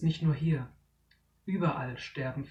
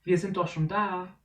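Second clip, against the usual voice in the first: calling out; 3 semitones higher, 6 dB louder than usual. Acted. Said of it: "ruft Tiberius".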